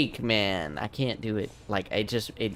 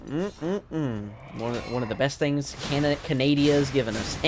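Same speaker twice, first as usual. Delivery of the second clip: ordinary talk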